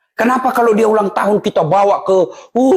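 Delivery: ordinary delivery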